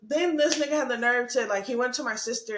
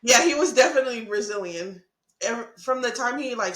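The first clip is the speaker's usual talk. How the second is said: ordinary talk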